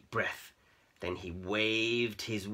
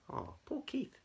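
sympathetic; 8 dB quieter than usual. A narrator's reading. Sympathising with sad cat.

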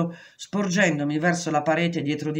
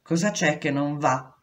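usual speaking voice

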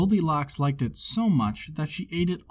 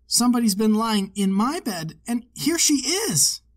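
put-on much higher, tiny baby bear squeak; little wee bear voice; 8 semitones higher, 4 dB louder than usual. A narrator's reading. Baby bear finds his porridge all gone, high tiny baby bear voice. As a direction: squeak it like the smallest cub. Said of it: "in his little wee voice".

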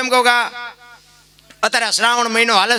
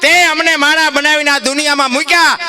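preaching at full force; 8 semitones higher, 4 dB louder than usual. Acted. Preacher voices saints crying to the Lord, fervent preaching. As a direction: wail it out like a saint pleading.

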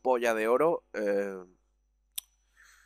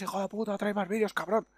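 higher, softer mock heckle; lightly voiced viewer comment; 7 semitones higher, 5 dB quieter than usual. Viewer demanding more videos in the comments, lightly mimicked. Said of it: "me lo decís en comentarios".

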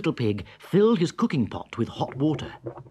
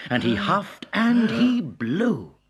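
rising dramatic blowing; 9 semitones higher, 5 dB louder, slower than usual. A narrator's reading. Narrator acts the wolf blowing.

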